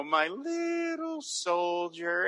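very slow and soft, syrupy tender; sweet Frank. Retold or imitated. Frank's will calling Margaret his little soldier, very slow and soft. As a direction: croon it like a fake-loving husband. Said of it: "And he's so sweet".

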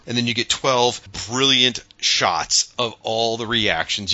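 normal talking voice

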